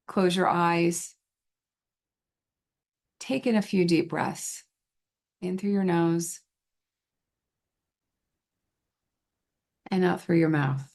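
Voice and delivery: calm guided relaxation with long pauses between phrases; guided visualization. Shown as a demonstration. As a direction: lead them gently into calm, leaving space to breathe.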